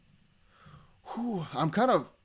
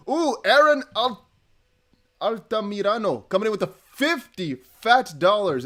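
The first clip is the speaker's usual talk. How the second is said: higher, louder, slowed hype for a big donation; excited; 5 semitones higher, 6 dB louder, slower than usual. Real, not acted. Streamer thrilled by a fifty dollar donation, excited. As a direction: draw the name out with delight.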